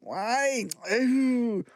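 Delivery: high whimpering sob; blubbering. A narrator's reading. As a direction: bawl like a small boy. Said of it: "Martin tudede".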